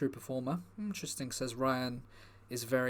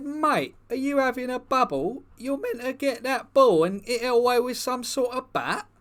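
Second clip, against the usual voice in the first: mock incredulous retort; 8 semitones higher, 6 dB louder than usual. Incredulous retort to granddad in story.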